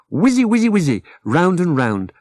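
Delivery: playful spinning sound words; whizzy. A narrator's reading. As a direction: let the whizzy words swirl up. Washing machine spinning.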